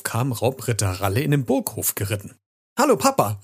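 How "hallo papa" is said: put-on high, loud child's greeting; excited shout to a parent; happy call. A narrator's reading. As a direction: call out joyfully, pitch way up. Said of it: "rief Rocco".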